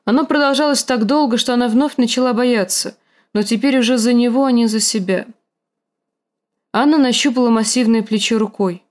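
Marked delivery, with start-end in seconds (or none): none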